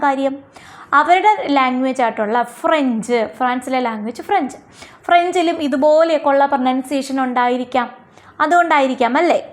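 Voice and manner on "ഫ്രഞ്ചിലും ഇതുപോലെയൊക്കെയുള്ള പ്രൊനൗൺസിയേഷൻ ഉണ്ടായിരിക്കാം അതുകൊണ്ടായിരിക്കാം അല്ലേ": normal talking voice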